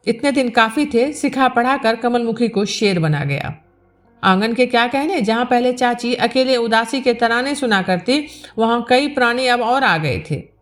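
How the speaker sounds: usual speaking voice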